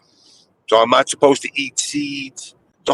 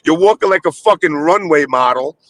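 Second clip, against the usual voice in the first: ordinary talk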